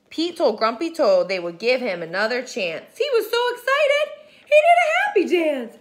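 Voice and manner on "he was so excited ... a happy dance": high, loud and very fast with a laugh; excited. Acted. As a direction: burst with joy. Excited narration of dance.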